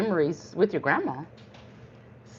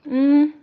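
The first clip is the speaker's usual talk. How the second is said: savoring hum; 10 semitones higher, 8 dB louder than usual. Real, not acted. Tasting the bread pudding.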